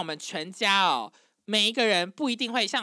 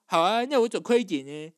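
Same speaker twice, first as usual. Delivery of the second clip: imitating a bratty, displeased reply, louder; mock sulky teen tone; 5 dB louder than usual. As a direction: mimic a huffy, overdramatic teenager giving in. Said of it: "就用那种很 中二、 很不爽的语气". (模仿中二又不爽的语气)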